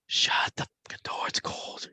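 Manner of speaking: soft unvoiced whisper. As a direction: breathe it like a ghostly voice. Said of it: "you hear a whisper now".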